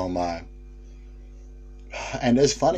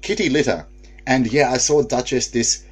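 usual speaking voice

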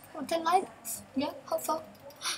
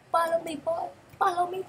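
pleading follow me; 8 semitones higher, 6 dB louder than usual. Acted. Begging viewers to follow him.

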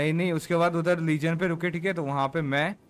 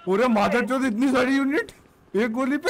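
outraged outburst; 8 semitones higher, 4 dB louder than usual. Real, not acted. Outraged at the whole unit arriving.

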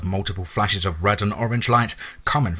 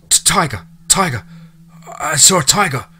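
higher, stammering terrified whimper; 8 semitones higher, 5 dB louder than usual. Acted. Captive answering his interrogator, terrified whimpering stammer. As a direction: stutter it out in terror. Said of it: "uttered quietly ... while whimpering".

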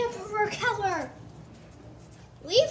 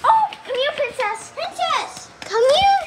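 loud, high calling to a pet; calling out loudly; 6 semitones higher, 10 dB louder, slower than usual. Real, not acted. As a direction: call the pet over across the room.

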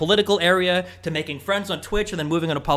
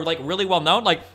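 ordinary delivery

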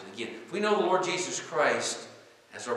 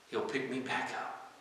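normal talking voice

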